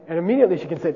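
ordinary delivery

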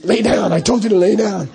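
mean lama's scolding. Retold or imitated. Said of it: "he was really mean".

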